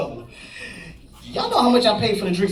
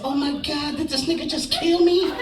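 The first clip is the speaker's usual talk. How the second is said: lightly camped-up, higher outcry; 7 semitones higher than usual. Acted. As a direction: ham up a sassy dramatic shriek. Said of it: "I snapped into character".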